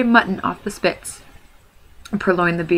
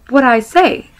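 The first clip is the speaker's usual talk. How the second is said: gasping hobbit; 6 semitones higher, 8 dB louder, slower than usual. Acted.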